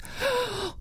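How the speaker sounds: sharp high gasp of horror; shocked gasp. Acted. Mother sees toads fall from her daughter's mouth, gasping. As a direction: gasp in fright.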